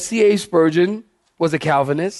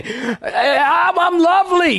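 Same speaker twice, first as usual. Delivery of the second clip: higher, hammed-up crying; mock sobbing; 8 semitones higher, slower than usual. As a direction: fake a teary self-pity. Mock weeping over being lovely.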